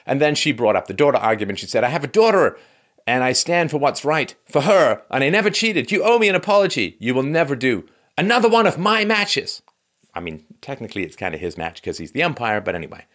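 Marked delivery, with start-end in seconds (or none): 1.8-9.6 high-pitched, emphatic mock outrage; Serena Williams's indignant outburst. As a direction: demand an apology at a raised pitch. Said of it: "Serena Williams".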